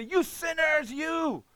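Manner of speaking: high, condemning mock preacher shout; a lightly voiced judgmental preacher; mock street preacher. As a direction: ham up a finger-pointing preacher on a rock. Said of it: "go on top of the rock and say".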